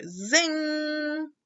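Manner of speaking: playful zing; zingy sound word. A narrator's reading. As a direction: ring it out.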